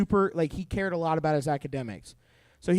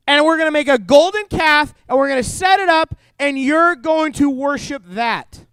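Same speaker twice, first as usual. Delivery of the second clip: loud, slowing command; 7 semitones higher, 9 dB louder than usual. Retold or imitated. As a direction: proclaim it like a leader giving orders. Aaron repeats the order louder.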